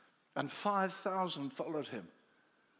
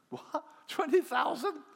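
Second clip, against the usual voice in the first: incredulous cry; 11 semitones higher, 7 dB louder than usual. Acted. Disciples astonished at the command.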